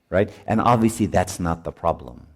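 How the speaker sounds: normal talking voice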